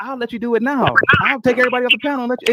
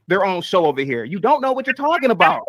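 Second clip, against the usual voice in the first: ordinary talk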